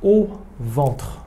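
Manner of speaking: slow, careful French model; slow model phrase. Shown as a demonstration. A teacher models the phrase slowly for students. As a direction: spell it out slowly for learners. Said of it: "Onaka ga itai desu ne".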